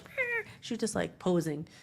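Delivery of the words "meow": a soft, high imitated meow; high cat meow. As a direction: mew like a posing cat, softly and high. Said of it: "you know how some cats are like".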